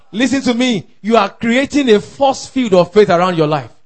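normal talking voice

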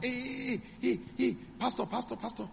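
high excited calls of "Hey" and "Pastor"; excited shouting. Retold or imitated. Carpenter spotting the pastor, calling out high and excited. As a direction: call out high across the street. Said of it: "he started shouting".

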